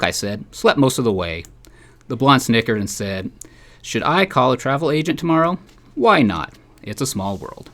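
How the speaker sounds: dry, deadpan storytelling read; humorous column reading. Acted. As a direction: read the column aloud to a listener, keeping the jokes dry. Reads his humorous column aloud.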